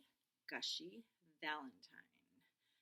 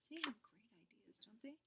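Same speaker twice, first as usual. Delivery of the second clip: whisper; 4 semitones higher, 8 dB quieter than usual. Real, not acted. Teacher aside to the children.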